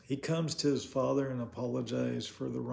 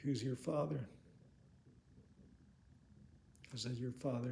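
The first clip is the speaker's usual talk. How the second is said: hushed and inward, much softer; quiet inner thought; 3 semitones lower, 10 dB quieter than usual. Retold or imitated. Preacher's silent question to a stranger, hushed. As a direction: think it to yourself under your breath. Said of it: "I'm thinking to myself".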